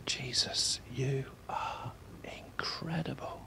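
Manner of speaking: slow, reverent whisper; whispered worship. Shown as a demonstration. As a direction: whisper it slowly to Jesus, awed. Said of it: "if you whisper it and say".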